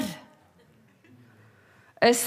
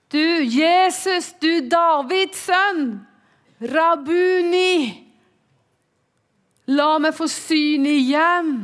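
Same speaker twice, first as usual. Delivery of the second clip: blind man crying out; 7 semitones higher, 4 dB louder than usual. Retold or imitated. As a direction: call out, begging. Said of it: "så roper den blinde".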